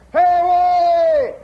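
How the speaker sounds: long, high, loud holler; drawn-out shout from the upper deck. Retold or imitated. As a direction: holler it across a ballpark, stretching the name. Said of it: "my old man would holler".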